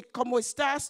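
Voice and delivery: loud ¿Cómo estás? to a stranger; the rude mistake, loud; wrong familiar greeting. Shown as a demonstration. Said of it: "to a stranger".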